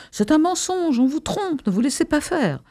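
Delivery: high-pitched protest from the crowd; crying out. A narrator's reading. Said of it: "quelqu'un dans la foule cria". (cri de protestation, voix haut perchée)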